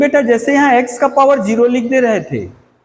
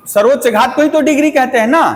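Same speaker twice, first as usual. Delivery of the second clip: fast, higher-pitched reminder seeking agreement; quick check question; 5 semitones higher, much faster than usual. Real, not acted. Teacher checking that students recall the definition, quick and rising. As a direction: rattle off "सर्वोच्च घात को ही तो degree कहते हैं ना", higher, rising on "ना".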